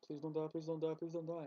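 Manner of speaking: quick repeated plea under the breath; mock begging, words run together; pleading. Owner begging her old cat to live, soft pleading.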